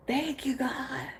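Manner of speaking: high breathy whispered gratitude; whispered thanks. Retold or imitated. Thanking God, awed whisper.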